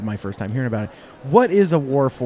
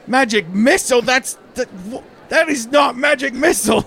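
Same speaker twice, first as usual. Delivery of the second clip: higher, louder incredulous shout; indignant protest; 11 semitones higher, 5 dB louder than usual. Real, not acted. Game master objecting to the player's spell, shouting. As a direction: protest with laughing disbelief.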